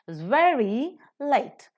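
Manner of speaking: slow rhythmic beats on "very" and "late"; stress on very, late. Shown as a demonstration. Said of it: "you pronounce a bit faster".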